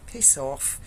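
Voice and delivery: quietly fed-up aside; fed-up inner thought. Retold or imitated. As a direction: mutter it to yourself behind the door. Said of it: "and I just thought".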